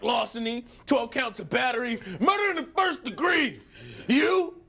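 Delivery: high, loud list of charges; hardened cellmate. Acted. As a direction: rattle off crimes like a proud convict. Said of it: "asks the cellmate".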